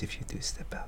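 fast hushed whisper. Acted. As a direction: whisper it quickly so others miss it. Secret whisper to David.

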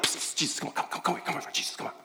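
urgent hushed whisper, pulling him aside. Retold or imitated. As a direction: whisper it secretly to call Jesus over. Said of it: "Peter's like".

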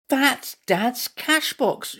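high, slow, amazed exclamation; excited shout. A narrator's reading. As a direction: exclaim at the discovery, drawn out. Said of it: "shouted Timothy".

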